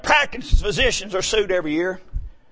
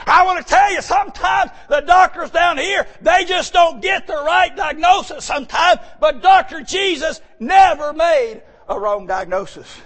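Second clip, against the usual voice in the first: high, loud hammering sermon cry; full-force preaching; 10 semitones higher, 4 dB louder than usual. Acted. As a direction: preach it out over the congregation, ringing.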